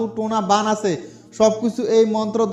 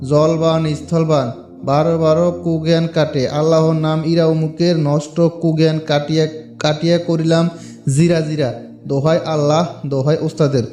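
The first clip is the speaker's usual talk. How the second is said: slow, deliberate chant for learners to copy; careful mantra recitation; 5 semitones lower, 4 dB louder than usual. Shown as a demonstration. Teaching viewers the exact pronunciation, slow deliberate chant. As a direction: spell it out line by line as a model. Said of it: "আপনাদের বোঝার সুবিধাতে আমি মন্ত্রটা আবার বলতেছি".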